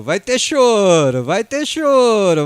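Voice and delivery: provocação arrastada e alta, bem devagar; provocação cantada. Acted. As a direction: cantarola a provocação lenta e forte. Provocando a torcida rival. (zoação provocativa arrastada)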